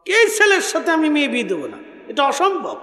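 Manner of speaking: high-pitched refusal falling sharply at the end; acted outcry. Mother rejects the groom outright, acted outcry. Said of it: "মহিলা চিৎকার মেরে বলে উঠল".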